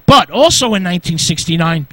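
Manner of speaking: ordinary delivery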